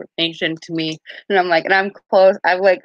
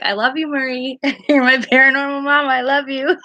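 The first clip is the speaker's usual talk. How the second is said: higher, giggly gushing with a laugh; mock fangirl squeal at Marie; gushing love; 8 semitones higher than usual. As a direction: gush over her like a fan.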